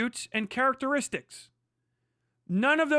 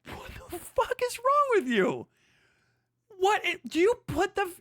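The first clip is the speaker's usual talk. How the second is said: incredulous; 7 semitones higher than usual. Real, not acted. Streamer outraged at clip.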